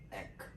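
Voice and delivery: "ek" said as a short e then a crisp k; sound ek. Shown as a demonstration. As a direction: say "ek" briefly, with the k clipped. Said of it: "les prononce comme".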